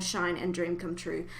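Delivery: ordinary talk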